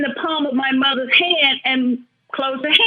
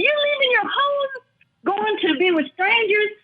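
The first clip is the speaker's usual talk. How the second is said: tearful plea; 9 semitones higher than usual. Real, not acted. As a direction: voice breaking up high with real tears. Pleading with dying father, tearful.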